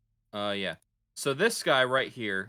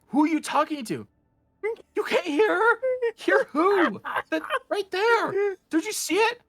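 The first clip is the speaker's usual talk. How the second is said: panicked sailor; 6 dB louder than usual. Acted.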